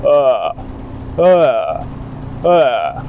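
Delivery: low "Uh" creaking in the throat; creaky low uh. Shown as a demonstration. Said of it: "Just everyone go".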